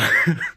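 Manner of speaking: short amused chuckle; real laugh. Real, not acted. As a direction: laugh briefly at the memory. Amused recalling a favorite joke.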